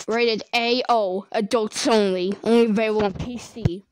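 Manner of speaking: loud rating-board announcement; mock announcer. Narrator jokes a rating warning, mock announcer. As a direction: read it like a game ad.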